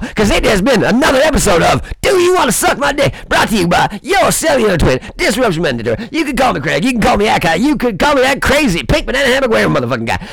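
high, shouted outro host voice; show sign-off. Acted. Signing off his episode, hammed-up announcer shout.

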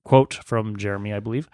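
ordinary talk

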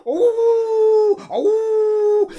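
sharp high yelp of fright, loud; a loud startled yelp; frightened high cry. A narrator's reading. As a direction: yelp as the dogs charge. Peter frightened by dogs.

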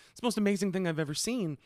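high, fast, breathless gushing; gushing crush. Retold or imitated. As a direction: rave it like she's starstruck. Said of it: "She's like".

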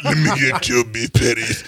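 imitating a friend's deep voice. Retelling friend's patty order to the group, deep mock voice. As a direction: drop low to mimic a deep-voiced boy. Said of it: "Red's voice was deep from grade seven".

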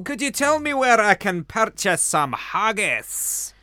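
put-on high, loud Scottish accent; hammed-up Scottish accent. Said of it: "I can do a very good Scottish accent".

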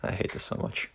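breathy whispered aside. Acted. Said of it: "said Hillary".

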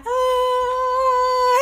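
high frightened "Oh" from the dad; a hammed-up yelp of fear; mock panicked holler. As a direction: cry out like a scared father in the air. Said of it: "My daddy was hollering like".

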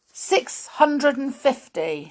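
loud, high, slow answer; bold answer. A narrator's reading. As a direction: announce it boldly to the teacher. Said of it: "said Peter boldly".

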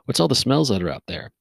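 ordinary talk, nothing marked

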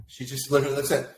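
ordinary talk

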